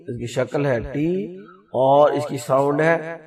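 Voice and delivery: normal talking voice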